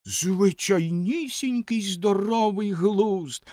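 high, drawn-out scornful echo; slow high-pitched indignation; indignant snort. A narrator's reading. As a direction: throw the words back slowly and indignantly. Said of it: "обурено пирхнула Ракета".

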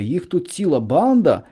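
plain reading voice

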